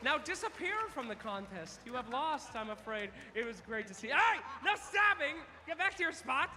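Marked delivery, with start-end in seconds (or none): 4.1-6.5 playful telling-off. Real, not acted. Host scolds contestants playfully.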